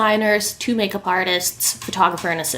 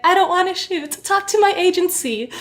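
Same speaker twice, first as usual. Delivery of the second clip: mock crying; 9 semitones higher than usual. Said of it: "she's crying".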